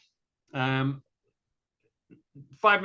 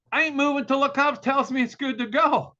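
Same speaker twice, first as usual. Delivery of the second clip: high, raised-voice refusal; defiant; 10 semitones higher, 4 dB louder than usual. Retold or imitated. Motorist refusing tow truck driver's order, defiant. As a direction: dig in, pitched way up.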